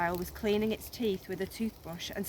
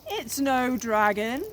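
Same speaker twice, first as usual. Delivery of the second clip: slow, louder booming creature voice; deep melodious voice; 4 semitones higher, 8 dB louder, slower than usual. A narrator's reading. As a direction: roll it out grandly. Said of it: "said a deep, melodious voice".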